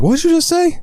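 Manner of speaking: very high, incredulous mock grandpa; imitated scandalized old man; indignant grandpa. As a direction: challenge the kid in a squawking high voice. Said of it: "his grandpa's like".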